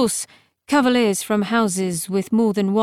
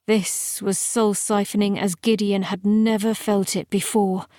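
ordinary delivery, nothing marked

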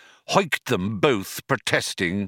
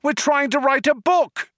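very high, indignant yell; 4 dB louder than usual. A narrator's reading. Ford pleading against ejection, yelling. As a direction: squawk the protest at the top of your range. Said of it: "yelled Ford".

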